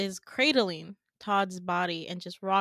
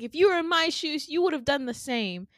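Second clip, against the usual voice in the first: high, defensive retort; acted frustrated protest, raised pitch; 7 semitones higher, 4 dB louder, faster than usual. Peach defending herself to her friends, frustrated. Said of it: "Peach starts to get frustrated with us".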